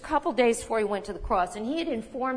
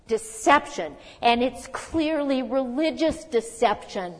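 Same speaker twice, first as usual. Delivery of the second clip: normal talking voice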